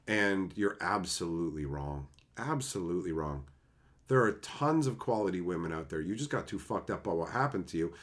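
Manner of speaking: low, soft earnest advice; quiet sincere talking-to; sincere lecture. Real, not acted. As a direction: set him straight gently.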